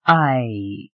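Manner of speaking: hesitating; hesitant. A narrator's reading. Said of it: "Gwena replied hesitatingly".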